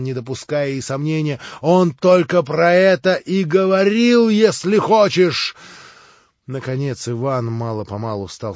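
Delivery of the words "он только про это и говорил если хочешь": feverish insistence. Acted.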